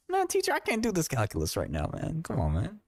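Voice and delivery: high-pitched complaining schoolkid; pleading student. Acted. Acting student pleading with teacher.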